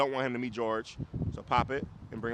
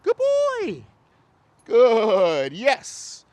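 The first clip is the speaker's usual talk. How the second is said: much higher and louder, bright praise; dog praise; 9 semitones higher, 12 dB louder, much slower than usual. Real, not acted. Trainer praising the dog for walking right, high excited praise. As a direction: reward the dog with your voice. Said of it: "So I gotta praise to help him".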